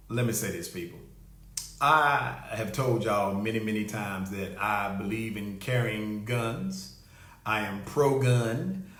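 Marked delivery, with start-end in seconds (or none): 0.1-4.6 low and quiet. Real, not acted. Host confiding to listeners, low and quiet.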